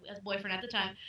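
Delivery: ordinary talk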